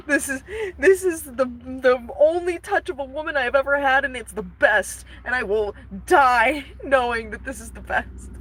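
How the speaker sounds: high, melodramatic lovesick Phantom; hammed-up dying-of-love swoon. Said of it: "he's like".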